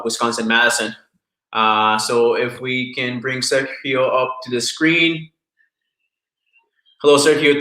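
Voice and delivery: normal talking voice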